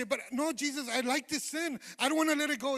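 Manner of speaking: high, loud mock refusal; acted protest. Voicing a believer refusing Jesus over a sin, acted protest. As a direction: protest loudly like someone clinging on.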